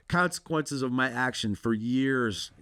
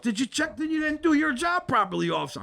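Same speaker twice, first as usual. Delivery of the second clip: mock the cop's lazy police work; sarcastic mockery; 11 semitones higher than usual. Mocking the officer about the neighbor's claim, high sarcastic sneer.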